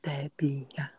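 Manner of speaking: breathy secret whisper. Real, not acted. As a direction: whisper the name to yourself. Whispered aside while betting.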